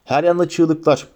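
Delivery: ordinary delivery, nothing marked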